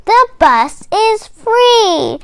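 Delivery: ordinary delivery, nothing marked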